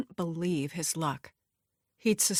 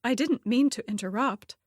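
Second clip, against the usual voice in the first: higher, mildly flustered apology; a touch of awkward stammer, pitched up; 5 semitones higher, 4 dB louder than usual. A narrator's reading. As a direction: excuse yourself for walking in. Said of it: "she stammered".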